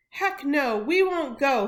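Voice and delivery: loud, high defiant chant; father siding with daughter, shouting; crying out. A narrator's reading. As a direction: shout it, joining the protest. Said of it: "he finally cried".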